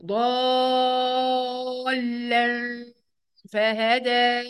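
"ضالا" with a very long, slow heavy aa; six-count madd. Shown as a demonstration. Teacher showing how to say it, "ضالا" hugely prolonged. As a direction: stretch the vowel of "ضالا", then hum before "فهدى". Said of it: "أقولها كده".

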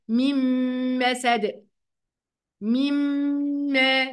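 very slow "مما" as mimmm-maa; nasal ghunnah. Shown as a demonstration. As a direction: hold a long humming m in "مما". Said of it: "هي دي الغنة".

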